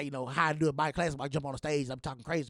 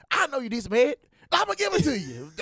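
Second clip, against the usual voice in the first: mock voice; 8 semitones higher, 4 dB louder than usual. Retold quote, high mock voice.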